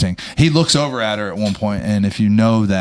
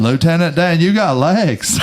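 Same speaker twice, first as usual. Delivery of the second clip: high, drawled movie quote; Forrest Gump impression; 6 semitones higher, slower than usual. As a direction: imitate Forrest calling out. Quoting Forrest Gump line.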